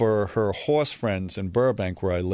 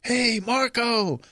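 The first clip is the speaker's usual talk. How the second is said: higher calls from across a hall; calling out; much slower than usual. Retold or imitated. Fans in the amphitheater call out to Marco, much higher calls. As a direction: call his name from the crowd. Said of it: "people are yelling out".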